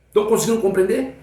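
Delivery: normal talking voice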